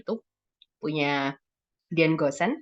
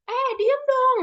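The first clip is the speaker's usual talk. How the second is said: very high-pitched scolding yell; acted yell for silence; mock yelling; 3 dB louder, slower than usual. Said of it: "teriak-teriak".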